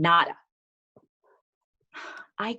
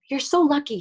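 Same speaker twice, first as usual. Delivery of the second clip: put-on envious kid, pitched up; higher-voiced jealous best friend; 7 semitones higher, 4 dB louder than usual. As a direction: say it with a child's envy, higher. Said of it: "Elijah was so jealous".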